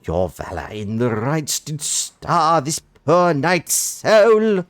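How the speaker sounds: high, eager, grandly posh oath; too enthusiastic knight. Acted. Knight swears service to the party, gushing posh. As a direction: swear fealty with gushing pomp. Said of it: "Gilman sounds too enthusiastic".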